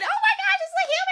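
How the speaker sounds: very high, loud squeal of delight; hammed-up thrilled squeal; imitating excitement. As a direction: gush it like an overexcited cartoon character. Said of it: "like, so excited".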